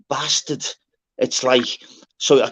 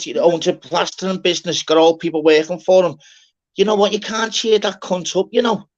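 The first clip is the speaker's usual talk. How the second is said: ordinary delivery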